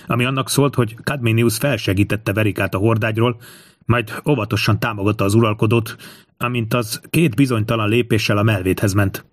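usual speaking voice

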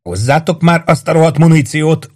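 high, angry yell for ammo. A narrator's reading. Sergeant yelling at the soldiers for ammunition. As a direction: yell at the men below. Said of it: "ordította".

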